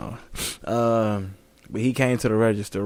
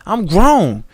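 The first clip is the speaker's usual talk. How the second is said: mock kid voice; 6 semitones higher, 6 dB louder than usual. Quoting kids.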